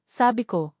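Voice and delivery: usual speaking voice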